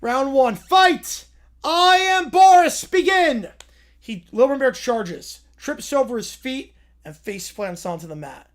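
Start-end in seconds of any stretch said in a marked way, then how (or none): 0.0-3.5 loud, slow ring-announcer proclamation; booming referee Boris. Acted. Boris announces himself to the fight crowd, slow and booming. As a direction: drag out each word like a grand announcer.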